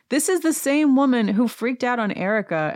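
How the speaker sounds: ordinary delivery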